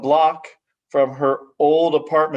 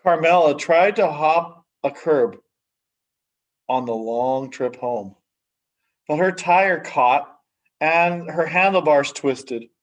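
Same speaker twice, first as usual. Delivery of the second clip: ordinary delivery, nothing marked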